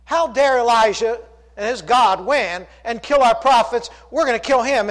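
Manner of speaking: upset threat. Retold or imitated. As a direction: spit out the outrage. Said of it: "She sounded a little upset".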